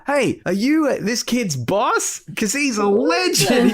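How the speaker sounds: high, slow, beaming praise; impressed customer. Retold or imitated. Customer praising the kid to his boss, high beaming voice. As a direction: gush to the boss about the kid.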